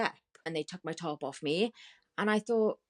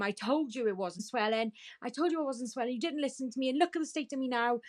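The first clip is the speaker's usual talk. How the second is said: higher-pitched, indignant complaint; retold angry protest; 8 semitones higher than usual. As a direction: throw the accusation at them, pitched up. Retold confrontation with clinic staff.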